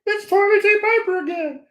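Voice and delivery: high squeaky toilet paper puppet voice. Retold or imitated. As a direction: put on a high cartoon puppet voice. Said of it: "he kinda talks like this".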